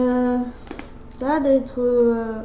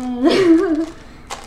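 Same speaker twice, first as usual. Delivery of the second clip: bursting out laughing; short loud laugh; 5 semitones higher, 7 dB louder than usual. Real, not acted. Laughs about pink products.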